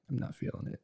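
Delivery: sighed, breathy and soft; unimpressed sigh. Retold or imitated. Julian turning down the watch, sighing softly. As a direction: let it out deflated. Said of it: "He's like".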